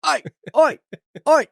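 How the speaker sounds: high, hammed-up British "oi"; bad British accent. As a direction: call the dog in a mock British accent. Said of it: "That is the worst accent I've ever heard".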